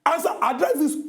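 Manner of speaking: high, forceful command; impatient order. Acted. Host orders co-host to address the matter, impatient. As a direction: demand an answer sharply.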